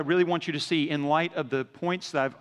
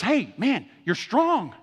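encouraging hype; 7 semitones higher, 5 dB louder, slower than usual. Acted. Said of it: "the Lord comes back and says".

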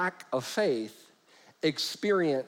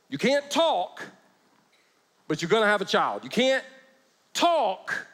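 high punch on "talk"; stress on talk; 5 semitones higher than usual. Shown as a demonstration. Preacher hammering home "can't talk" to the congregation. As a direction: lift "talk" high above the rest. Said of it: "this is important".

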